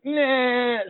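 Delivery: high, drawn-out mock "nah"; dismissive nah. Game master voicing a bearded character, dismissive. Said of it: "then he's like".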